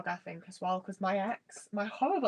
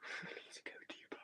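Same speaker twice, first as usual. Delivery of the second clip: whispered note to self; muttered aside; 19 dB quieter than usual. Real, not acted. Baker talking to themself while digging for ingredients, whispered aside. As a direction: whisper it under your breath.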